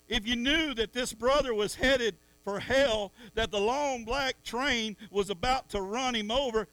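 loud, driving sermon climb; fiery preaching. Real, not acted. Preacher urging the church to rescue a lost brother, loud and driving. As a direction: preach it hard at the congregation.

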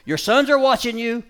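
high, loud coaxing to the dog; urging the dog. Real, not acted. Trainer coaxing his dog not to take the bait, loud and high.

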